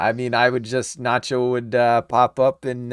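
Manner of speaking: ordinary delivery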